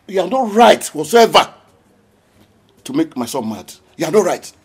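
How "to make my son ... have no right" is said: normal talking voice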